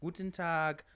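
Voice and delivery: German accent. Shown as a demonstration. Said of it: "I do know how to do a German".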